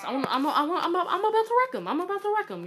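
high, squealing eager stammer; giddy high-pitched trash talk; hyped-up boasting. Real, not acted. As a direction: wind yourself up, pitch climbing high. Hyping himself up to win.

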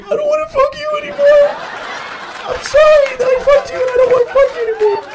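very high, loud blubbering plea; whiny plea. Retold or imitated. Scared teen begs her to stop, high loud mock crying. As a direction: whimper and beg to go home. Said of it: "Finally, I was like".

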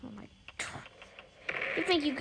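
normal talking voice

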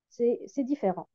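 ordinary talk, nothing marked

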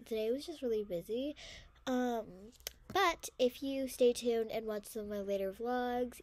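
blocked nose, stuffy voice; congested. Real, not acted. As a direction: talk through a congested nose. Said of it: "I'm congested, so that's why I'm talking like this".

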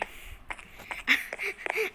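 high breathy giggle; nervous giggle. Real, not acted. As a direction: giggle off the fright. Giggle after fright.

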